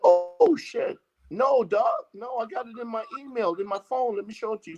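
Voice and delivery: high-pitched, alarmed pleading; urgent protest. Retold or imitated. Accused man offers officer proof on his phone, urgent protest. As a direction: jump up in pitch as if arguing innocence.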